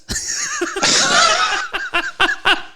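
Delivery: high burst of real laughter. Real, not acted. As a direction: crack up at the memory. Laughing at the toilet story.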